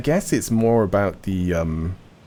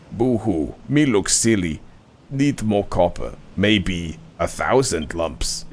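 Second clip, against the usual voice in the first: lightly voiced slow-witted stone golem, broken speech; a hint of a lumbering golem talking; simple-minded golem. Golem complains, boo-hoo.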